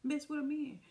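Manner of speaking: high, loud scoff; mocking him, high and indignant. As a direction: call him out. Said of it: "I'm like".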